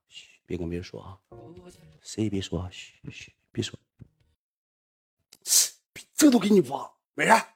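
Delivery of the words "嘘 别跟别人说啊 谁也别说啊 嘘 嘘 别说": much softer, hushing, the last shh breathed out; hushed secret. Retold or imitated. Telling buddies to keep it secret, hushed and soft.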